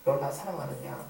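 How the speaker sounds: low, hushed question; gentle. Acted. Jesus asking Peter a third time about love, gentle. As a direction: ask it softly and tenderly.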